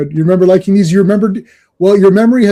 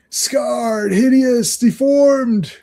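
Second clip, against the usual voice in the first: higher, drawn-out theatrical read; dramatic caption reading; 5 semitones higher, much slower than usual. Acted. Podcaster reading comic text aloud, hammed-up.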